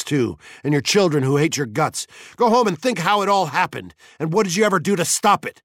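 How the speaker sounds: tirade rising from firm to loud and high; building anger. A narrator's reading. Montag berates Missus Bowles, anger mounting. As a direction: build steadily toward a yell.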